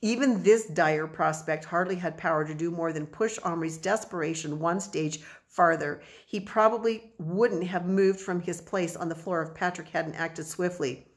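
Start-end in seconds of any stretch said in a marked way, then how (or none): none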